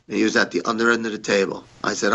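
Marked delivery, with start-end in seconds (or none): none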